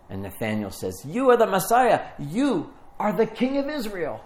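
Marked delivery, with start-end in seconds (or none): 1.1-4.2 high-pitched, excited proclamation; acting out sudden enthusiasm; a little enthusiastic. Nathanael declares who Jesus is, enthusiastic. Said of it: "He gets a little enthusiastic".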